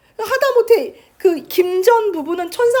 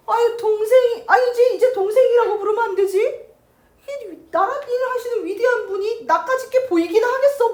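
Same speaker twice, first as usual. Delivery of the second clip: sarcastic, pitched up, mocking her brother; sarcastic jab; 7 semitones higher than usual. Sister mocking her official brother, sarcastic. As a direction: needle him with mock deference. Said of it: "막 비꼬는 거야".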